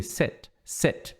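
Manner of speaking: "sit, sit" whispered with a short vowel between i and e. Shown as a demonstration. Teacher whispering the short vowel of sit. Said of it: "no meiozinho ali".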